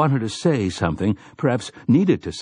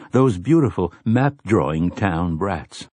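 plain reading voice

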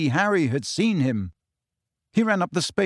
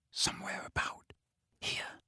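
whispering; 15 dB quieter than usual. A narrator's reading. Searching in hushed dark.

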